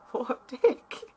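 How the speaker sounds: laughing. Real, not acted. Laughing comment.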